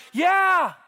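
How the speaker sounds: Jesus cheering on an amazing rescue story, loud and eager; enthusiastic yeah. Retold or imitated. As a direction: shout it out eagerly. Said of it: "And Jesus is going".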